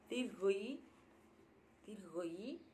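Dirgo-i twice, rising, mimicking the Bengali; Bengali letter name. Shown as a demonstration. Teaching viewers the Bengali long i, rising Dirgo-i. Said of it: "Es la I larga".

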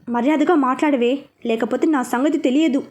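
ordinary delivery

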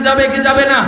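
the question shouted out loud to the crowd; loud call. Shown as a demonstration. Preacher rousing young men, loud call. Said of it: "আর একটু চিল্লাই বলেন".

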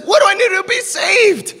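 high, desperate question from a terrified jailer; panicked plea. Retold or imitated.

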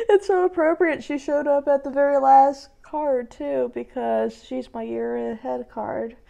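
high, strained, emotional squeal; real emotional high-pitched voice; overwhelmed, near tears. Real, not acted. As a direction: keep talking high straight out of a cry-laugh. Still laughing-crying at the card.

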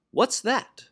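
high, indignant storybook snort; snorted question. A narrator's reading. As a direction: pitch it up in mild royal affront. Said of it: "snorted Yertle".

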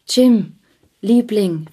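high, loud call of his name. A narrator's reading. Della calls out to Jim as he stares at her. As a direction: call out to him, pleading. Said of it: "rief sie".

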